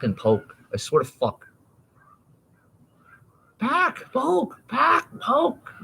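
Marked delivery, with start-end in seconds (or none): 3.6-5.7 very high crying bird call; crow imitation. Copying the crows outside, high bird squawk. Birds seem to say words.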